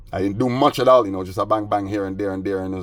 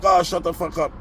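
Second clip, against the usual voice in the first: mocking imitation; 10 semitones higher than usual. Roommate imitated in quarrel.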